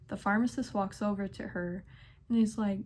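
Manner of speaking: usual speaking voice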